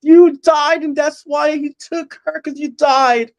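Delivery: high, slow, sobbing shout of blame; crying accusation. Retold or imitated. Officer in the nightmare blaming him, crying shout. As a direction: cry it out, voice pitched high and dragging.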